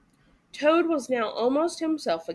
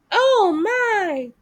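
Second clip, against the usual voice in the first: gasped; 9 semitones higher, 7 dB louder than usual. A narrator's reading. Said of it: "gasped poor Toad".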